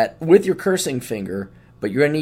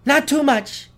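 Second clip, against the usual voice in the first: much higher prissy customer voice; mock spoiled customer; 11 semitones higher, 4 dB louder than usual. Mocking a spoiled customer ordering salt, high prissy voice. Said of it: "your life that you're like".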